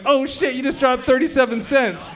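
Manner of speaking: high, quick excited callout; surprised exclaim. Retold or imitated. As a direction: blurt it out amazed. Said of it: "I was like".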